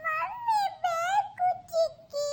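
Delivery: put-on drawn-out childish crying; tearful complaint. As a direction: sob out the words to auntie. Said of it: "ಅಳ್ಬಾರ್ದಮ್ಮ". (ಅಳುತ್ತಾ ಗೋಗರೆಯುತ್ತಾ)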